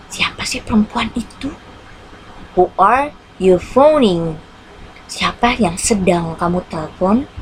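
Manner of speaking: ordinary delivery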